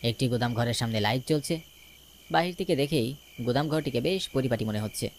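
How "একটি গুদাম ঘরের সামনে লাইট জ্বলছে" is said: ordinary delivery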